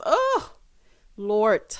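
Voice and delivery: breathless swoon over a handsome man. Real, not acted. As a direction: swoon at the sight of him.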